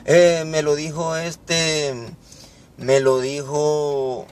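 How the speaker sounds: slow, dragged-out hesitation; trying to remember. Acted. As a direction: stall as if the name escapes you. Hijo olvidadizo intenta recordar. (haciendo memoria, lento y alargado)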